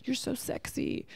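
mock a leering builder; catcalling worker. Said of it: "construction workers ... yell at women".

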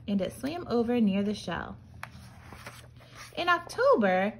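ordinary delivery, nothing marked

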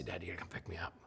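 whispered, sneaking the call; whispering a fib to dad on the phone; hushed lie to dad. Retold or imitated. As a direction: keep it hushed so no one hears.

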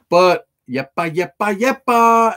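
high, drawn-out hype call; slow hyped-up chant; excited call. Real, not acted. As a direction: stretch out the yepa like a cheer.

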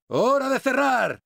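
high, drawn-out callout; rousing battle cry. Acted. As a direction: announce it slowly and high to the squad. Battle cry before welding door.

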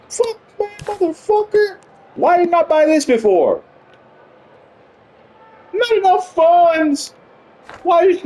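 high, drawn-out wail of dismay; anguished whining. Real, not acted. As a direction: lament in a high-pitched whine. Finds tanks he cannot afford.

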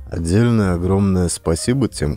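ordinary delivery

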